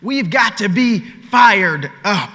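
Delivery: slow, loud, word by word; emphatic refrain. Real, not acted. Speaker driving the refrain home to the crowd, slow and emphatic. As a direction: land each word heavily.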